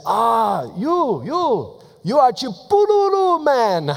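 high, loud excited greeting; excited villagers. Retold or imitated. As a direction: hail him like a local celebrity. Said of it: "people would say".

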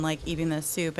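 ordinary delivery

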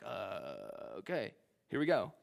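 a low nervous gulp, then a resigned okay. Retold or imitated. Job gulping at God's challenge, nervous and resigned. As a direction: Job gulps before God, bracing himself. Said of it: "you can almost hear out of the text gulping".

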